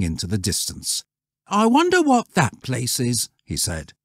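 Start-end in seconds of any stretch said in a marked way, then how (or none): 1.5-3.3 high, louder schoolboy voice; curious boy, pitched well up. Acted. Schoolboy character in read story.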